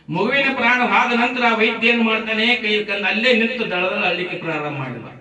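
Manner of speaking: high, loud climactic storytelling; impassioned preaching. A narrator's reading. Preacher tells listeners of the surgeon after the child's death, impassioned.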